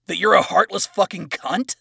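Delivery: enraged shout; furious insult. Acted. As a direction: hurl the insult at her. Adam insults Lilith.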